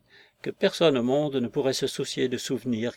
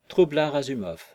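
ordinary delivery, nothing marked

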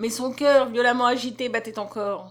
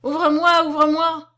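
high, loud pleading; desperate begging; 5 semitones higher, 7 dB louder than usual. Acted. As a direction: beg her to open, desperate. Begging mother to open.

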